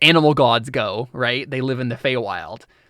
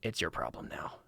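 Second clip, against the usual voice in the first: low, much softer dying words; final whisper; 9 semitones lower, 16 dB quieter than usual. Acted. Dying god hands the burden to Bootsy, low and soft. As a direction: breathe it out as his last words. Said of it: "he just says".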